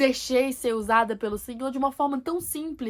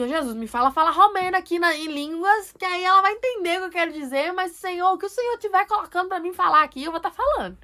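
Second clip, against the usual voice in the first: higher, louder urgent plea; pleading inner prayer; 7 semitones higher, 6 dB louder than usual. Retold or imitated. Believer asks Jesus for Romanian words, pleading. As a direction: beg Jesus earnestly, pitch held high. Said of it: "Na minha cabeça eu até falei assim".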